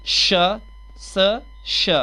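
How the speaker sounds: स and श sounded out one by one; isolated initial sounds. Shown as a demonstration. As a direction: alternate a hissed स with a hushed श, pausing between. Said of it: "तो एस इसमें स".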